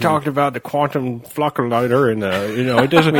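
high, loud nerdy fanboy voice; Trekkie nerd voice. Retold or imitated. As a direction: nitpick like an obsessive Trekkie. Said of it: "that's my Trekkie voice".

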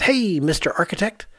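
ordinary delivery

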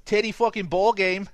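mock Boston accent. Canadian hamming a Boston accent for his co-host. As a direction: play a Boston guy in a Boston accent. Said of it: "A Canadian doing a Boston accent".

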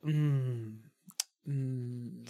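low, soft wistful hum; dreamy hum. Real, not acted. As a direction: hum as if daydreaming. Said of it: "Stop making that sound".

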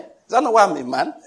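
a husband retorting to his wife, hammed-up; mock husband retort. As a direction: throw it back at her. Husband answers in quarrel.

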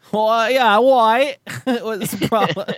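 high, hammed-up British accent; mock British accent. Joking about a fake name, in a mock British accent. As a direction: put on a silly British accent, laughing through it. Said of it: "start doing a British accent".

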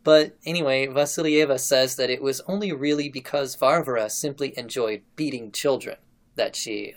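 usual speaking voice

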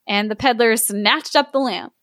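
ordinary delivery

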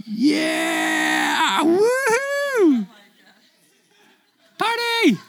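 high, whooping party cheer; mock party cheer. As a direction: whoop like you're celebrating. Mock celebration of cutting off head.

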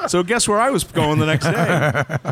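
high-pitched, laughing storytelling; amused, words riding on laughs. Real, not acted. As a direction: tell it through real laughter. Laughing over his story's punchline.

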